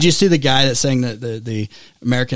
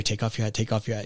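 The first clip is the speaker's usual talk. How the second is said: retold self-reminder; 3 semitones lower, 9 dB quieter than usual. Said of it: "he's, like, walking out there".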